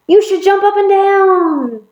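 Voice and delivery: very high, exclaiming friend; high-pitched, eager storybook call; excited urging. Acted. Friend excitedly urging monkey to jump.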